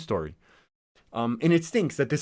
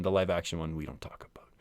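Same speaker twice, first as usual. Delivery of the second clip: whispered aside, much softer; conspiratorial whisper; 7 semitones lower, 6 dB quieter than usual. Real, not acted. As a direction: hush it like a secret nobody mentions. Aside about disliked remake.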